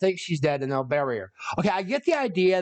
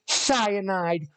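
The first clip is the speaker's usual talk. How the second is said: usual speaking voice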